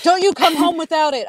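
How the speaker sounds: high and sharp threat; mock furious order. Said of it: "I was like".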